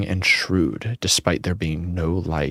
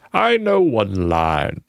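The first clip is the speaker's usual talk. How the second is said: put-on slow old man's voice; drawn-out ancient ghost; slower than usual. Voicing the old man.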